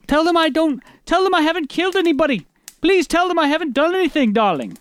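high, hammed-up English accent; high-pitched theatrical cry in a put-on English accent; bad English accent. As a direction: plead in a badly faked English accent. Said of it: "was that an English accent".

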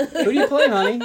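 high, loud doting mother; proud mom voice. Pretend.

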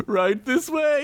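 very high giggly butler voice; giggling gnome butler. Acted. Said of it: "giggled the tiny gnome butler".

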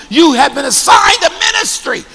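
rising shout on assigned; shouted declaration. Real, not acted. Preacher declares to congregation.

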